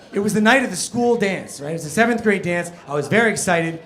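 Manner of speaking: ordinary talk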